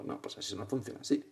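ordinary delivery

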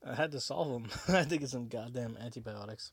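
normal talking voice

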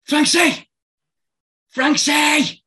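retold coach yelling a name, high and loud; shout. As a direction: bellow the name across the pitch. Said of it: "He was shouting".

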